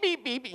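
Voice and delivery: a sheep that never makes the reversing beep. Retold or imitated. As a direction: chirp it like a backing-up alarm. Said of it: "backing up".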